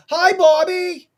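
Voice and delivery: very high, loud, drawn-out hello; mother's eager greeting. Retold or imitated. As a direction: imitate a sweet mother hollering hello. Acting out mother's cheery greeting.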